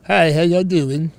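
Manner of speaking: mock foreign doctor with Southern drawl; Doctor Now. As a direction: greet as a foreign doctor who learned English in Texas. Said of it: "they've got that Southern drawl".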